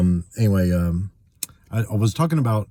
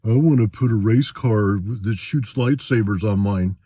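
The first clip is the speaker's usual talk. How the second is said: slow, louder mock-dumb voice; mocking the idiot; 2 semitones higher, 3 dB louder than usual. Ranting about card alterers, mock-dumb voice. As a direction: drag it out to sound like a clueless show-off. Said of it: "and some idiot's like".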